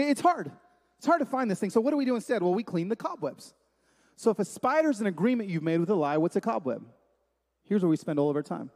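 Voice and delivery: normal talking voice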